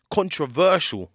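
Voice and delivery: "Controversial" drawn out slowly, high and loud; stress on CONTROVERSIAL. Real, not acted. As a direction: stretch and punch "Controversial". Host stresses the word.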